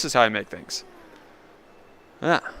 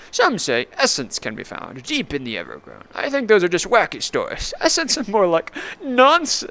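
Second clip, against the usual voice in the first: much higher hammed-up old timer; folksy NPC voice; 7 semitones higher than usual. Clarence explaining the Sunstone to the player, high folksy voice.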